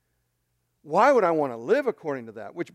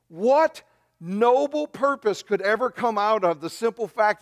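raised, emphatic rhetorical questioning; preacher pressing a rhetorical why; incredulous challenge; 4 semitones higher than usual. Real, not acted. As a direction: demand an answer from the congregation.

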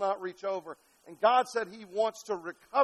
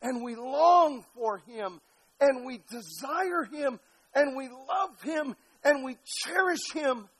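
high, slow, drawn-out pulpit delivery; devotional preaching; 5 semitones higher, slower than usual. Real, not acted. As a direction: draw out each declaration of devotion.

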